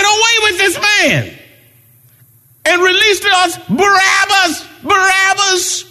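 high, full-voiced shout; loud, high chant for release; crowd shouting demand. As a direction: cry out like a mob demanding a prisoner. Voicing the crowd against Jesus.

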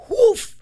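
sharp, high burst of breath; a loud, high "whoof" like something shooting out; whoof sound effect. Retold or imitated. Sound effect for projectile poop.

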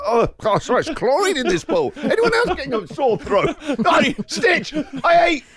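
high, laughing mock whine; hammed-up flustered swimmer making excuses; mock whiny self. Host mocking himself losing a swim race, high whine.

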